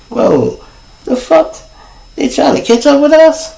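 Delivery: sudden high startled question. Retold or imitated. Spotting police in the mirror, high startled surprise. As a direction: jolt up in surprise.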